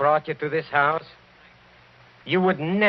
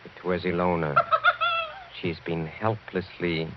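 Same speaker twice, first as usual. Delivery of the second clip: grim low revelation; 7 semitones lower than usual. Acted. Count's grim revelation, dropping low.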